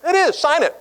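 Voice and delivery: high, bright teasing push; gleeful urging. Retold or imitated. Ali urges signing the photo.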